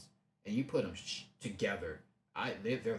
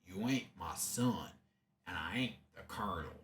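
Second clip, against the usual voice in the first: a rough imitation of the Colonel's drawling American accent, lower and fast at the end; 4 semitones lower than usual. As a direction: quote the line in the Colonel's country accent, lightly done. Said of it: "I don't know if that's the actual accent".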